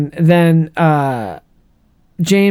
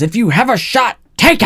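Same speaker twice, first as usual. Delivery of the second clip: higher, rising commander; over-the-top commander; 5 semitones higher than usual. Retold or imitated. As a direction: bark the order. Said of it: "that commander a little over the top".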